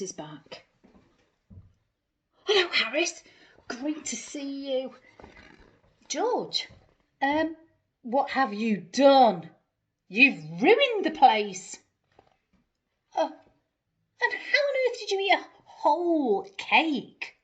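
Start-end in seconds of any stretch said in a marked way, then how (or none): 2.4-4.9 cheerful greeting. Acted. George greeting Harris. 6.1-17.4 loud, shocked owner telling the dog off; exasperated scolding. Acted. Harris scolding George.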